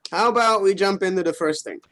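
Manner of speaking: usual speaking voice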